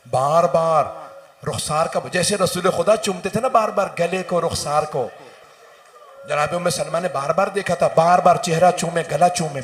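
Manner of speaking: loud, rising lament from the pulpit; full-force majlis preaching. Acted. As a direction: preach the grief out to the mourners. Majlis masaib recited at full force. (ज़ोरदार मजलिस का बयान)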